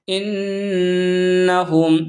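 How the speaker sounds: انہم read joined up in slow recitation. Shown as a demonstration. Teacher blending the spelled letters into انہم, slow recitation. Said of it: "تو ہم اس کو کھینچ کر پڑھیں گے".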